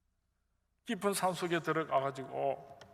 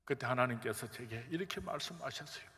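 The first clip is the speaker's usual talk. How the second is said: normal talking voice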